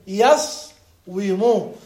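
يصوم said slowly as yas-wu-mu, with a w; underlying form. Shown as a demonstration. Teacher showing the verb's origin, يصوم pronounced slowly as yas-wu-mu. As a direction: break يصوم after the s: yas, then wu-mu. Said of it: "نقول أصله يصوم".

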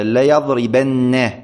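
ليضربن with a long doubled final نّ, lingered on; ليضربنّ with a heavy stressed nun. Shown as a demonstration. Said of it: "نون توكيد ثقيلة مشددة".

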